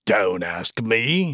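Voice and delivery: slow, drawn-out character voice; surprised, angry companion. A narrator's reading. Burly man answering his companion about the light, surprised and cross. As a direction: draw it out as the burly companion, understated anger. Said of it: "A cry of surprise and anger".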